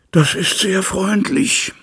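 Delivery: slow, high, sighed thanks; sighing. A narrator's reading. Jekyll seufzt zu Utterson, dankbar und langsam. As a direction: breathe the words out in a sigh. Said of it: "seufzte der andere".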